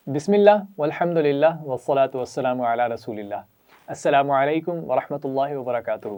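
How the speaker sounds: measured, recited Arabic opening; formal recitation. Acted. Teacher opening the lesson with a recited blessing, measured. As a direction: intone the opening blessing and greeting.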